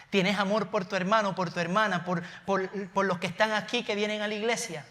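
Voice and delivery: ordinary talk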